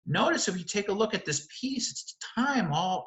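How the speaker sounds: ordinary delivery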